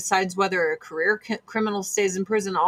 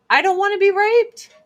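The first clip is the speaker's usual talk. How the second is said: high, loud, insistent cry of refusal; emphatic higher louder repeat; 11 semitones higher, 6 dB louder than usual. Real, not acted. High loud repeat to her viewers. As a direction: says it again much higher and louder to viewers.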